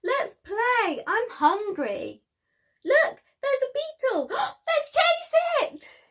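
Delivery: high, loud, bouncing lion cub voice; a restless cub who can't keep still; gasping cub chasing a beetle; excited cub. Acted. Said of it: "could not hold still".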